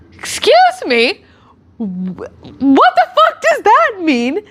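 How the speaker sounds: high, slow, drawn-out indignant question; stunned disbelief. Retold or imitated. Retelling how she questioned boyfriend John, high slow disbelief. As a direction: stretch out the shock, half laughing. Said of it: "And so I went".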